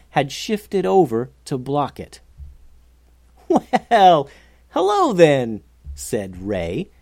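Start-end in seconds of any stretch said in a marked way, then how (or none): 3.5-5.6 loud, drawn-out awkward hello; louder stalling greeting to a hostile crowd. A narrator's reading. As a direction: stall with an over-friendly hello. Cornered Ray greets the trolls.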